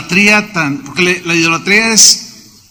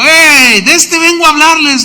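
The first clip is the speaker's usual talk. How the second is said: bold call out; 8 semitones higher, 4 dB louder than usual. Retold or imitated.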